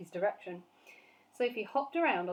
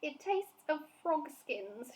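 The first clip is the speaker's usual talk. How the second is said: gasping; 7 semitones higher, slower than usual. A narrator's reading. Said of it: "she gasped".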